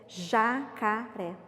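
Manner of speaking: JACARÉ very slowly in three separate syllables. Shown as a demonstration. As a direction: sound out "Jacaré" with a pause after each syllable. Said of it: "deixa eu ter certeza".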